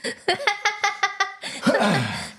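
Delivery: long burst of high-pitched laughter; uncontrolled high giggling fit; high helpless laugh. Real, not acted. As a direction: crack up completely. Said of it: "I'm sorry for laughing".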